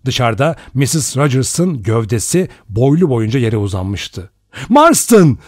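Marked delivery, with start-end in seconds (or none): 4.7-5.4 loud, much higher urgent call; shout. A narrator's reading. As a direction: shout for help across the house. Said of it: "diye bağırdı Lombard". (yüksek sesle bağırış)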